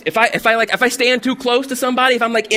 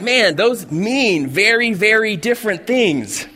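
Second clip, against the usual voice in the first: slow puzzled; slower than usual. Retold or imitated. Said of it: "And you're like".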